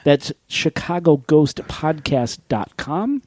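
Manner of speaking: slow, deliberately spaced "chicago ghost podcast dot com"; slowed right down. Shown as a demonstration. Host repeating the website for listeners, slowed right down. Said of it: "Did I say that too fast?".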